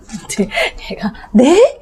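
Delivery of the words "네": high, loud startled "네?"; surprised. Acted. Child caught off guard by dad, loud and startled. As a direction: react like a kid caught out. Child's shock at dad's twist.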